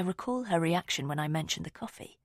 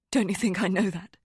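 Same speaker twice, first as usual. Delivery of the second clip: louder, defensive retort; snapping back; 2 semitones higher, 5 dB louder than usual. A narrator's reading. Rosamond snaps back at narrator in frustration.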